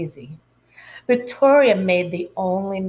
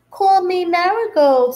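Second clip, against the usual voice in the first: put-on eager girl; 9 semitones higher, 5 dB louder than usual. Girl claims flower name.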